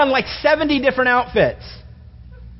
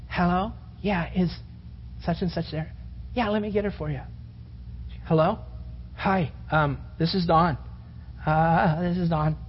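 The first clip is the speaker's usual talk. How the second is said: put-on shaky kid on phone; 5 semitones lower, 9 dB quieter than usual. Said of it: "your voice is all shaking".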